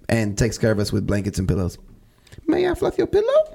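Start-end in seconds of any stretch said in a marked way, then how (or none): 2.5-3.5 high sweet flight attendant; chirpy cabin crew offer. Pretend. Mock flight attendant offering pillow.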